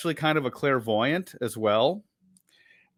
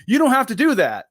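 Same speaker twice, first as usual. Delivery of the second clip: higher, louder, fast; excited high reassurance; 10 semitones higher, 6 dB louder, faster than usual. Real, not acted. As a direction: blurt it out brightly. Reassures listeners.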